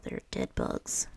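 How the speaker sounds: ordinary delivery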